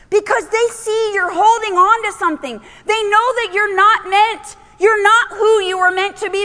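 urgent, high-pitched sermon delivery; full-force preaching. Acted. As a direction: drive each phrase home to the congregation.

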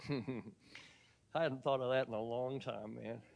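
soft, slow words through real laughter; laughing. Real, not acted. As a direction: chuckle your way through the memory. Laughing at his own story.

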